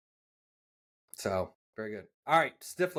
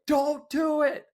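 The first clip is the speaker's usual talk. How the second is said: high, slow, loud warning; drawn-out, high-pitched cry of alarm; mock alarmed shout; 5 dB louder, slower than usual. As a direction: imitate a crewmate yelling to stop. Crewmate's warning acted out.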